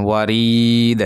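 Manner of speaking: ওয়ারিদ with the "ree" held long on a level note; stopping with long pull. Shown as a demonstration. Teacher shows how to pause, long i and bounced d in ওয়ারিদ. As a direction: draw out the i, then close with a bounced d on ওয়ারিদ. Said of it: "এই যে ওয়াকফ করবেন".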